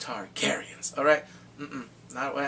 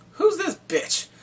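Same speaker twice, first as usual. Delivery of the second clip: high, loud sassy snap; sassy Lyanna; 8 dB louder, slower than usual. Retold or imitated. Lyanna sizing up Daenerys, high and loud and sassy. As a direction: sneer at the newcomer.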